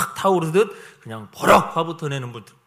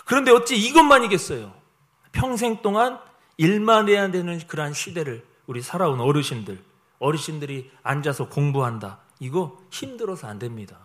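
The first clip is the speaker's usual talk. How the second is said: normal talking voice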